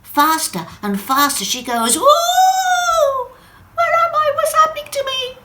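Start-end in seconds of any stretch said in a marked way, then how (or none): 2.0-3.3 very high loud swooping ooh; a startled cry of flight. Acted. Drop bursting from the tunnel, a loud high ooh. 3.8-5.4 much higher and louder, words rushing; a panicky little drop; alarmed Wendy. Acted. Drop falling from the tap, high, loud and panicky.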